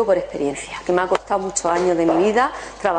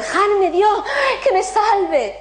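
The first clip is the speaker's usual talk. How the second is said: desperate plea; 10 semitones higher, slower than usual. Retold or imitated. Drowning man refusing the boat, frantic high plea. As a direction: beg the Lord frantically.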